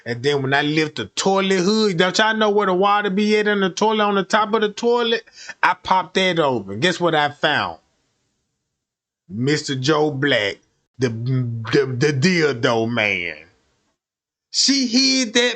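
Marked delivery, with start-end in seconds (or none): none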